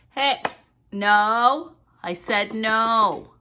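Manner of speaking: loud, slow, firm no to a pet. Real, not acted. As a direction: lay down the law. Scolding parrot again.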